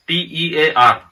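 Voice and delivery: T E A R spelled slowly, letter by letter. Shown as a demonstration. Teacher spells tear for the class, slowly. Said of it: "एक word है tier".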